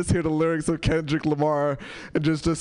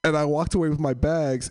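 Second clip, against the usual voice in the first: ordinary delivery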